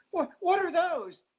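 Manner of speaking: high, slow horrified gasp; shocked young girl. Retold or imitated. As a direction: shriek it like a frightened girl. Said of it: "my parody of it in my mind".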